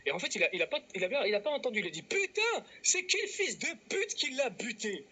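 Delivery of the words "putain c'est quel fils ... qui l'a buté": high, drawn-out cursing outburst; mock outraged cry. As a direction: wail the curse out high, imitating someone appalled. Mime la réaction indignée de l'autre. (cri indigné imité, aigu et traînant)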